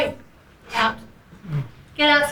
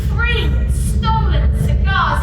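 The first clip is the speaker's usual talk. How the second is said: drawn out word by word; slow emphatic; 3 semitones higher, 3 dB louder, slower than usual. Acted. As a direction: spell out each word slowly. Boss slowly stresses the count.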